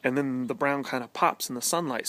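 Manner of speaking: ordinary delivery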